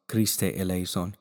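ordinary talk, nothing marked

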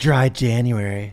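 slow, loud "Dry January"; careful Dry January. Shown as a demonstration. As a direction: say "Dry January" slowly and clearly. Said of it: "That was in English".

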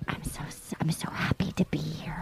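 hushed voiceless whisper. Retold or imitated. Host imitates the contestant whispering her happiness, hushed whisper. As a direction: breathe it out like a secret. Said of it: "And then she whispers".

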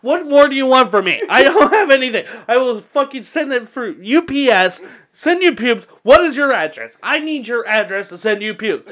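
high, exasperated mock rant; yelling back. Drunk caller pleading with spell caster, yelling. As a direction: re-enact your yelling phone tirade. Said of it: "you start yelling back".

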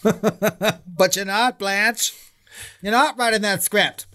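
high, slow, drawn-out movie diva; campy diva retort. Pretend. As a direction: camp it up like an old film diva. Campy Baby Jane style retort.

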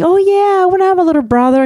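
put-on high, eager little-kid answer; eager child. Mother imagining her son saying yes, high eager kid voice. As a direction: pipe up like a keen small boy.

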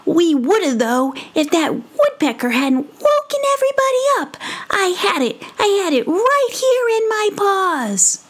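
little mouse protesting, high-pitched and indignant. Acted. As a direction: insist you had it, a little offended. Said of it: "Brisbane was a bit indignant".

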